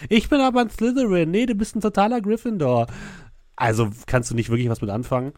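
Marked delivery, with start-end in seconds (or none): 0.1-1.3 high, loud fangirl squeal; mocking fan. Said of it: "sagen solche Sachen wie". 1.3-2.9 high, loud excited fan voice; mocking fan. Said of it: "sagen solche Sachen wie".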